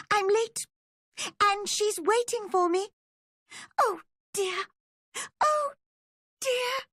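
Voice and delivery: very high, frantic rabbit squeak. A narrator's reading. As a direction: squeal it in a flustered panic.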